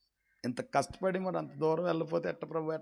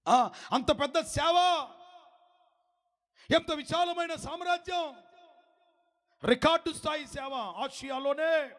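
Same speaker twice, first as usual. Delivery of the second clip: higher, slowed, near-crying cry of a preacher; fervent preaching; 12 semitones higher, slower than usual. Acted. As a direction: lament it from the pulpit, voice cracking. Preaching at full force.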